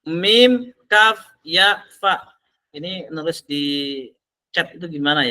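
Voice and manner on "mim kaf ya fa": "Mim, kaf, ya, fa" named letter by letter, higher and clear; letter-by-letter spelling for the class. Shown as a demonstration. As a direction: spell out each Arabic letter distinctly. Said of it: "Bahasa Arabnya adalah mukayyifun".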